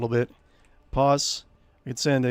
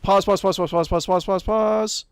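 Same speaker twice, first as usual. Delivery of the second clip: frantic repeating; 7 semitones higher, 5 dB louder than usual. Real, not acted. Frantic to pause the game.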